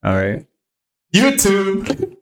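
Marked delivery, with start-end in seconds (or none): 1.1-2.2 high, drawn-out greeting; high intro call. Pretend. Host retries the channel intro, high hammed-up call.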